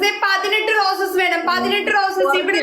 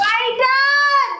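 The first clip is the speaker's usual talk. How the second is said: excited call; 6 semitones higher, much slower than usual. Real, not acted. Thanking gifter during live battle.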